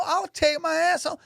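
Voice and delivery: very high hammed-up quitting threat; mock angry threat. Guard threatening to quit on the lady, high mock rant. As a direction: fling out I'll take my ass home in a high put-on voice. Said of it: "they're arguing in front of everybody".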